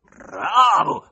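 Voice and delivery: loud short growl from the gallery; growled bravo. A narrator's reading. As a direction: bark it from the top balcony. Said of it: "ringhiò brevemente una voce di basso".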